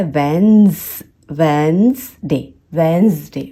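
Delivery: drawn-out "wenz", then "wenz-day" for वेडनेसडे; slow two-syllable वेडनेसडे; silent D and E. Shown as a demonstration. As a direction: build वेडनेसडे from "wenz", no middle e. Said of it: "ये वाला ई भी silent होता है".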